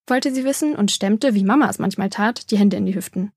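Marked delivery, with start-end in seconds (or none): none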